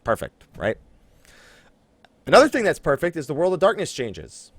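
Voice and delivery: ordinary talk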